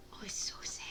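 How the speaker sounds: breathy whisper, it's so sad; whispered sadness. Real, not acted. Streamer whispering about the sad game.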